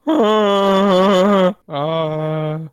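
a hint of slow Doctor Phil host voice, loud start; hammed-up TV host welcome, drawn out; Doctor Phil impression. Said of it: "That was my Doctor Phil".

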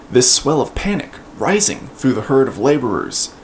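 usual speaking voice

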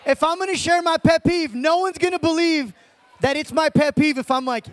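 usual speaking voice

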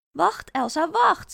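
high, loud call after someone leaving; urgent calling out. Acted. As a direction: call her back before she's gone. Moeder roept Elsa wanhopig terug. (hoog en luid nageroepen)

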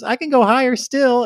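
put-on very high, loud little-girl voice; high kid voice, proudly calling down; Madeline. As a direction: imitate a child calling from the treetop. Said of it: "And she goes".

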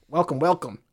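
stiff flat machine greeting; robot voice. Retold or imitated. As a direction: say it like a talking machine. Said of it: "said to me in a robot voice".

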